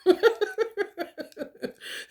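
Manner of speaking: high laugh; bursting into laughter. Real, not acted.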